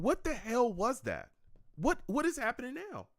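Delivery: high, rising shocked questions; stunned disbelief. Real, not acted. Streamer asking the chat about the clip, high and stunned. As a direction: call out to the chat, amazed. Reacting to odd draft footage.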